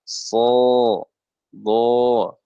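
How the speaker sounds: "so, do" long and heavy, hollow inside the mouth; thick from inside. Shown as a demonstration. Teacher models thick letters, "so, do" full from within. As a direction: thicken "so, do" without pushing the lips. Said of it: "Tebalnya di dalam".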